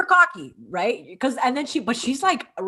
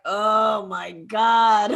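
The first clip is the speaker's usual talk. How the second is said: long-drawn, very slow groan of disbelief; exasperated reaction; 2 semitones lower, 4 dB louder, much slower than usual. Retold or imitated. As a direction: drag out each word in mock exasperation. Her private reaction to the guy.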